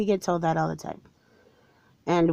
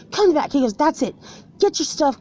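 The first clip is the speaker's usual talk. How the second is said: angry boss; 6 semitones higher than usual. Retold or imitated.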